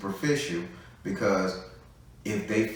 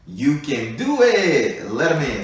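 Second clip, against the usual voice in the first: loud, high, drawn-out cheer; loud, high-pitched, stretched pep talk; rousing encouragement; 6 semitones higher, 9 dB louder, slower than usual. Acted. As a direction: belt it out slowly to urge someone on. Echoing the performed cheer to client.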